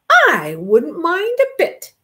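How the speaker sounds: slow, high friendly groundhog; lightly voiced groundhog. A narrator's reading. Groundhog calls out to the rabbit. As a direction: offer it kindly and slowly. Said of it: "exclaimed a voice".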